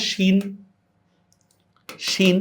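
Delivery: শিন opening on a clear "sh"; sh sound. Shown as a demonstration. Teacher shows students the Arabic letter, শিন with "sh". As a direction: say শিন with an S-H hiss. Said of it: "শিনের উচ্চারণটা কেমন?".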